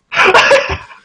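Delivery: high, sudden laugh; real burst of laughter. Real, not acted. Podcaster delighted by the writer's gag, real burst of laughter.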